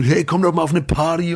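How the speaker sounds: lightly hammed-up come-along call; mock party invite. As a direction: imitate someone urging you along to a party. Mimicking pushy party invitations.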